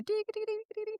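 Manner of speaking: high, soft tinkling "dee-dee" run; light, high plinking notes; cartoon xylophone. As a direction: imitate a little cartoon tiptoe tune. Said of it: "like, a xylophone plays".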